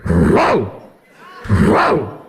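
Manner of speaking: deep imitated bark; gruff dog bark. A dog heard from the other side of the park, deep barking.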